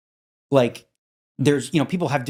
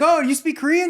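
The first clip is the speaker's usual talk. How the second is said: joking; 12 semitones higher, 3 dB louder than usual. Retold or imitated. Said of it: "that's why I joke with him".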